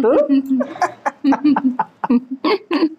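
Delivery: gleeful laugh after the reveal; prankster's laugh. Real, not acted. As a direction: laugh at your own prank.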